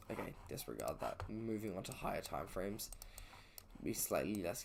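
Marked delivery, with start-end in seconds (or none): 0.1-1.2 whispered aside. Real, not acted. Muttered self-correction aside.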